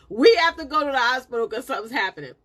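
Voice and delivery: loud, quick, laugh in the voice; laughing retold urgency. Wife telling husband about the hospital, laughing urgency. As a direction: urge him along while cracking up.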